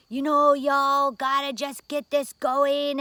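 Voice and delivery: slow, loud, sing-song preacher lilt; Jesse Jackson preacher voice. Retold or imitated. Jesse Jackson urging Japanese executives, slow preacher lilt. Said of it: "speaking like a preacher".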